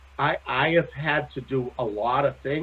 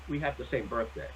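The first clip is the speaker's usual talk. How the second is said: ordinary delivery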